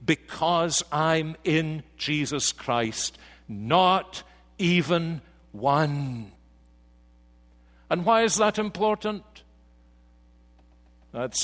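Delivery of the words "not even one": each word of Not even one set apart, very slow; slow emphatic. Shown as a demonstration. Preacher proclaiming to the congregation, slow and weighty. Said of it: "a proclamation".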